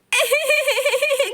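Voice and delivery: high cackling laugh; grandma laughing. Acted. Grandmother laughs at grandson's hurry, high laugh. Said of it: "हंसिए नहीं please".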